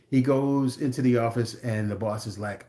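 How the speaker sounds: ordinary delivery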